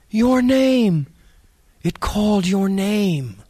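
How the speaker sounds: high, frightened cry; terrified boy. A narrator's reading. Scared boy warning his friend, high frightened cry. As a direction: blurt it in terror.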